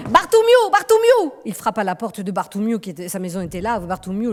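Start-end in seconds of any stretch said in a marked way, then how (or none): 0.1-1.3 high, loud call to a neighbour; knocking at night, calling the neighbour. Acted. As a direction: shout the name up at the window.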